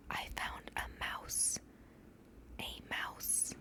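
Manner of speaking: hushed dramatic whisper; whispered, slowed reveal. Real, not acted. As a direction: breathe it like a secret.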